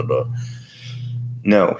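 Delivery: normal talking voice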